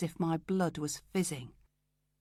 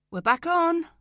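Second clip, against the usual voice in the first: high, loud and bright; triumphant; 12 semitones higher, 9 dB louder than usual. Acted. Telling Jay the case is back on, high and loud. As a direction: burst in with the good news.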